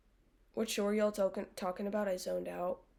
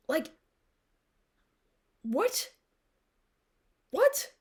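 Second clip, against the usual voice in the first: surprised; 6 semitones higher, slower than usual. Real, not acted. Disbelief at graduation plot.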